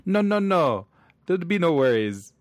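high, loud mock Jamaican patter, slowing on coach; jokey fake Jamaican, the guy bluffing as coach; bad Jamaican accent. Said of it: "I say".

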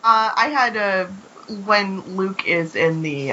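ordinary delivery

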